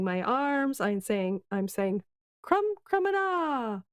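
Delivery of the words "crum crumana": high imitation of a squeaked magic word; mimicking the mouse. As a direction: copy the mouse's high call, stretching the ending. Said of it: "try and mimic that".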